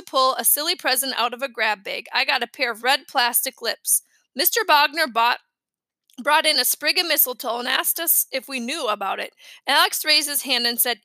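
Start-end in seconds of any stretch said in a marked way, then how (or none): none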